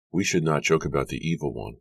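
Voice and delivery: plain reading voice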